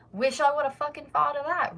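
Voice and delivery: high, loud mock admiration; sarcastic. Sarcasm about obvious suggestion.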